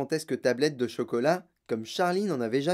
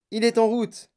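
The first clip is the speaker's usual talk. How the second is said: high, loud, excited cry; exultant call; shouted; 7 semitones higher, 5 dB louder than usual. A narrator's reading. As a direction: shout the news triumphantly. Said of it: "cria monsieur Wonka".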